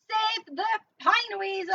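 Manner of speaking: loud slow rallying cry; dramatic shout. Acted. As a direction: proclaim it like a campaign slogan. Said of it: "reading game card dramatically".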